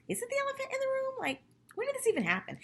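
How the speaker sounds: high, fast, puzzled squeak; baffled inner thought. Retold or imitated. Host recalling her own confusion, high and puzzled. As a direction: jump way up as if confused. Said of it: "I was thinking".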